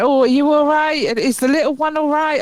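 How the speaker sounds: a hint of a bubbly chatty cabbie; chatty driver.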